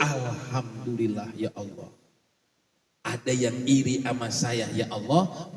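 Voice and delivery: soft, low murmured thanks to God; quiet prayer. Acted. Mother praying when envied, soft and low. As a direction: pray it gently under your breath. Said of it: "langsung Ibu buru-buru".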